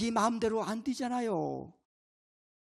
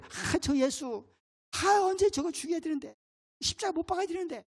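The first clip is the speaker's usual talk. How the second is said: scheming Pharisee; 6 semitones higher than usual. Acted. Pharisees plotting against Jesus.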